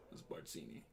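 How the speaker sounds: breathy whisper, no voice at all; whispered confession. Retold or imitated. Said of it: "He's like".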